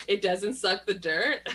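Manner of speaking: slow "suck the dirt" rising on "dirt"; "It doesn't suck the dirt" as a slow, lilting question; question intonation. Retold or imitated. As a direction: imitate her, lifting "dirt" into a question. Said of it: "she says it like a question".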